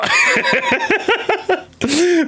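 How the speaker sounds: a sudden high cackle; high laugh burst. Real, not acted. Streamer cracking up at his own joke, high laugh.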